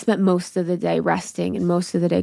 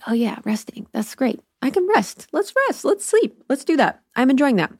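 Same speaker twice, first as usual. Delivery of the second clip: high, singsong little voice, hammed up; mock delight; 7 semitones higher than usual. Telling herself she is happy to rest, mock delight. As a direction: pitch way up, sweetly overdoing it. Acted eager response to resting.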